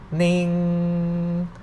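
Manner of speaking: "ning" held level, drawn out; mid tone. Shown as a demonstration. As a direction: sustain each "ning" on one even pitch. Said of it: "no tone mark represent the mid tone".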